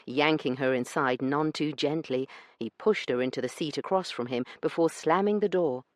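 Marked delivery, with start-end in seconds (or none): none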